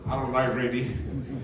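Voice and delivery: low, laughing sheepishly; laughing admission. Retold or imitated. To Coach Bill Whittle about reading, a sheepish laugh. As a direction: confess it through a laugh.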